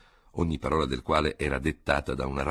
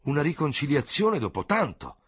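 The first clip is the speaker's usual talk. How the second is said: exclaiming; 10 semitones higher, 3 dB louder than usual. A narrator's reading. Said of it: "esclamarono insieme".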